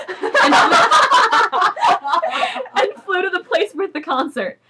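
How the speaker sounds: high-pitched laughing through the words. Real, not acted. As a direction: lose it laughing mid-sentence. Reader cracks up at absurd text.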